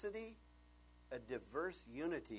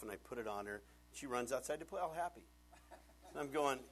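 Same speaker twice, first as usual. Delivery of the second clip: ordinary talk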